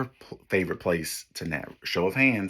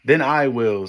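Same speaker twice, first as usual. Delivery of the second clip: louder, higher firm hen; determined hen; 6 semitones higher, 9 dB louder than usual. Acted. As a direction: announce it proudly as the hen. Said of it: "Remember, I'm the hen".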